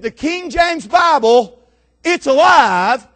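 high, loud, drawn-out announcement; acted showman's big reveal, shouted; triumphant shouted proclamation. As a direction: proclaim it slowly to the whole street.

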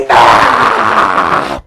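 long loud roar, dropped in pitch; enraged roar. Retold or imitated. Pastor capping his rant at the couple, long enraged roar. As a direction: let out a long harsh yell of rage. Wordless outburst ends the rant.

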